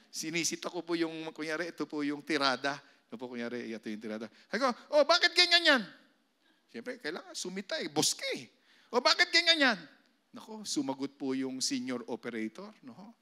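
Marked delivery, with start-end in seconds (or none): 4.9-5.8 loud, high scolding boss. Retold or imitated. Boss scolding operator in story. 8.9-9.8 loud, high scolding boss. Retold or imitated. Boss scolding operator in story.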